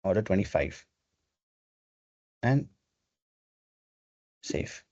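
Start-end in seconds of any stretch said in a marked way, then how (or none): none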